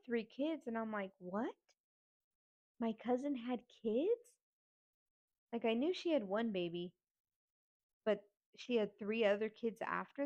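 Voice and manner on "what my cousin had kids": drawn-out incredulous question, rising at the end; shocked disbelief. Retold or imitated. Niece learning her dead cousin had kids, incredulous. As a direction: retell stunned disbelief. Retold shock at hidden children.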